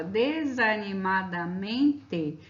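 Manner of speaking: slow, stretched-out "desanimadamente"; "desanimadamente" spelled out syllable by syllable at half speed; drawn-out long word. Shown as a demonstration. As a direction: say "desanimadamente" slowly, drawing out each syllable. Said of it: "É uma palavra enorme".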